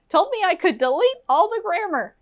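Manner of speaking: high, quick student voice; tattling student. Pretend. Student tells teacher about YouTube advice, high mock voice. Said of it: "Don't tell your teacher".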